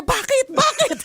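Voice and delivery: high-pitched, rattled mock questioning; retold panic. Wife imitating her nervous husband rushing over, mock panic. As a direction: imitate a flustered person demanding why, voice jumping up. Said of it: "Pero yung taranta niya".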